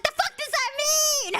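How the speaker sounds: high-pitched, incredulous squawk; indignant outburst. Retold or imitated. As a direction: burst out high in disbelief. Indignant retort to being told off.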